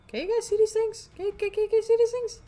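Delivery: high, loud frantic question; panicked. Real, not acted. Player panicking about the creatures, high and frantic. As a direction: stammer in alarm.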